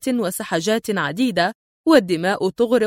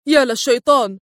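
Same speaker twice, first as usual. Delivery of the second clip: "يا للشيطان" yelled louder, pitched higher and falling; sharp yell; 3 semitones higher, 4 dB louder than usual. Acted. Ford realizes the impostor and yells. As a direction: cry out "يا للشيطان" in alarm. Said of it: "وفي حدة صرخ فورد".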